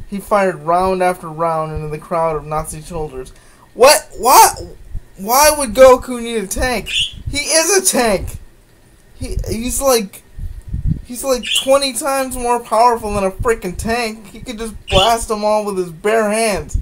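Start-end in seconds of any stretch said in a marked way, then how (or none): 3.7-8.4 loud, high, disbelieving shout; incredulous outburst. Real, not acted. Reader outraged by silly story. 9.1-16.8 exasperated rant. Real, not acted. Ranting at story logic.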